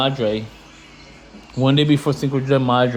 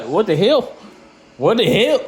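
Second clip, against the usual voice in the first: higher-pitched Chicago-style rising what the hell; Chicago guy; 6 semitones higher than usual. Retold or imitated. Reacting to the refusal email, mock Chicago outrage. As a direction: play a fed-up Chicago dude repeating the question. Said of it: "sounding like some motherfuckers from Chicago".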